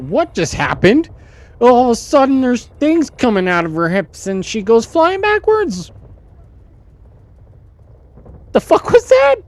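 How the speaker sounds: shocked yelling. Real, not acted. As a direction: scream out your disbelief at the screen. Shocked by sudden in-game death.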